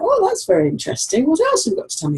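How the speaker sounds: high, delighted voice for a pet; high doting pet talk; talking to the dog. Retold or imitated. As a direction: chat up to the little dog, all high. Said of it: "I'm like".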